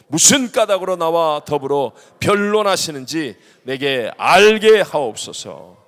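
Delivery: loud, slow, declaimed complaint to God; impassioned scripture reading. Acted. Preacher acts out Job's bitter prayer, loud and slow. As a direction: pour out Job's grievance with heavy, drawn-out emphasis.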